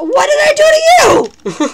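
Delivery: high, loud panicked yelp; startled shout. Real, not acted. Gamer suddenly attacked by another player, shrieking high. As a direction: squeal out a shocked protest.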